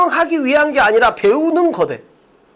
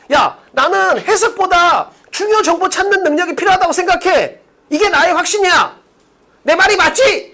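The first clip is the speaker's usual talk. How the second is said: higher-pitched, pushy acted claim; insistent self-assertion; 6 semitones higher than usual. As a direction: press your opinion on them, demand agreement. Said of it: "이게 아니고". (자기가 맞다고 우기듯이)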